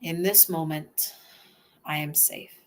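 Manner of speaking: usual speaking voice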